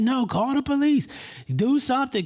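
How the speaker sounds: high, loud, urgent demand; imitated hysterics. Young woman demanding bystander get help, imitated hysterics. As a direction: beg loudly with the pitch pushed up. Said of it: "She's in hysterics".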